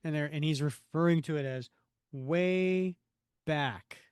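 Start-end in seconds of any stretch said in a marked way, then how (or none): 2.1-4.0 slow, weighty quote with a gap mid-phrase; drawn-out emphasis. Retold or imitated. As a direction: stretch both words, pausing between them.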